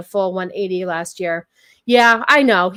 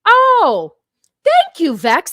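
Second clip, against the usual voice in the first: oh thank you squealed much higher and louder; a sudden high delighted gasp of thanks; 7 semitones higher, 8 dB louder, much slower than usual. Real, not acted. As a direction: squeal the thanks like you're touched. Gushing thanks after a compliment.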